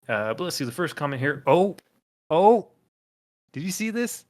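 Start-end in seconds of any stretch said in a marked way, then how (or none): 1.5-2.7 loud, high startled gasp; real shock of surprise; surprised. Real, not acted. Spotting the commenter's username. 3.5-4.2 high, amazed question; thrilled to point it out; excited. Real, not acted. Spotting the commenter's username.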